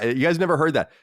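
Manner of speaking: ordinary delivery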